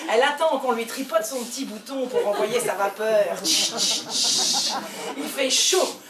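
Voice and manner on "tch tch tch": steam hiss. Acted. Steam hissing from pressure cooker.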